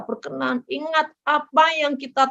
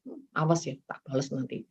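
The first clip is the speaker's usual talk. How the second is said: inner grumble; 8 semitones lower, 9 dB quieter than usual. Retold or imitated. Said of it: "Dalam hati".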